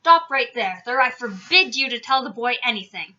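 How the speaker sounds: loud, forceful order; blustering loud command. A narrator's reading. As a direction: forbid it with authority. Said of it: "he commanded".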